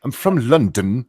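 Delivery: lightly mimicked London accent; mock London accent. Teasing the Londoner about proper British enunciation, mock London accent.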